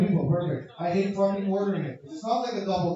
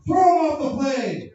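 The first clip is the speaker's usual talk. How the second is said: loud, high passenger yell; mock mob yelling; angry mob shout; 9 semitones higher, 7 dB louder than usual. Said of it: "it would've been like".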